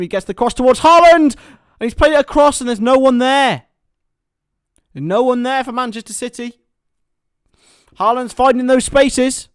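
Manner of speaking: rising play-by-play, loud and high; hammed-up match commentator; excited commentary. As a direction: call the cross like a live commentator. Mock commentary on FIFA match.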